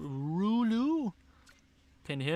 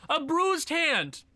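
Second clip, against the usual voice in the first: dismayed disbelief; 8 semitones higher, much slower than usual. Real, not acted. Reacting to injury news.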